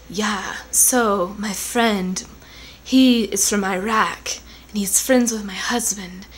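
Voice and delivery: slow breathy whisper of a radio commentator; mock the soft, drawn-out radio voice; soft-voiced radio woman. Vlogger mimics the NPR commentator, slow and whispery. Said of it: "this woman with a really soft voice".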